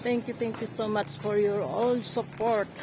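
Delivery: usual speaking voice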